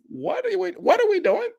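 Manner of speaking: high, laughing "what are we doing"; laughing disbelief. Real, not acted. A commentator laughs in disbelief at the priest's recordings, high laughing.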